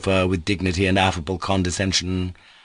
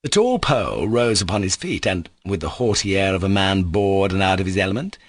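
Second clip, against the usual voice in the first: ordinary delivery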